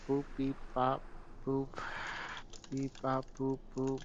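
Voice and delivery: beep boop robot. Pretend. Making robot noises while undressing.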